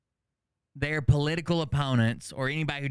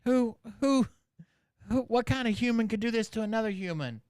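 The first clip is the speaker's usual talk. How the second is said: mock outraged streamer; 8 semitones higher than usual. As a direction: ham up her fake horror, pitched way up. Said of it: "And then she's like".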